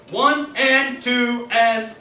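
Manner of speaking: counted and sung slowly; slow, deliberate count and dee dee dee; slow tempo. Shown as a demonstration. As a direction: take One and two and B at a slow tempo. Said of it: "I'm gonna take it a little bit slow".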